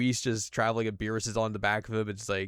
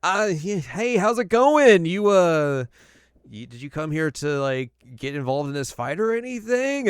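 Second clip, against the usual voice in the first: high, loud nervous babble; nervous Frieza; 7 semitones higher, 7 dB louder than usual. Retold or imitated. Frieza nervously greeting Lord Beerus, high and loud. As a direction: grovel with forced cheer. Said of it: "he's like".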